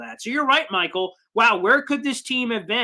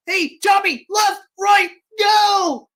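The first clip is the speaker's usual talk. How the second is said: high, loud directions yelled downfield; backyard quarterback shout; 10 semitones higher, 6 dB louder, much slower than usual. Acted. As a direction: bark out calls like a scrambling quarterback. Acted backyard quarterback directing teammates.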